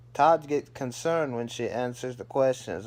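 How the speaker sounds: usual speaking voice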